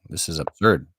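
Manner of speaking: normal talking voice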